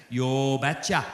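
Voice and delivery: low Norwegian father's "Yo betcha"; "Yo betcha" in a heavy Norwegian lilt; thick Norwegian accent. Retold or imitated. Norwegian father agreeing to the marriage, in a thick Norwegian accent. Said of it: "in his thick Norwegian accent".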